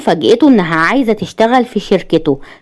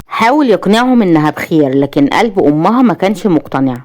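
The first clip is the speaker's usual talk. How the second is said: ordinary delivery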